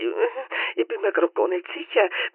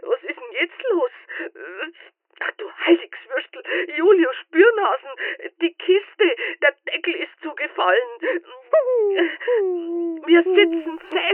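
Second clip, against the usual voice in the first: alarmed; 9 semitones higher than usual. Acted. Dog trapped in box.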